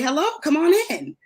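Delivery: high, bright welcoming hello; friendly greeting. Pretend. Host modelling how to greet a colleague at the office, high friendly welcome. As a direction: greet a visitor at the door. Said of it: "and say".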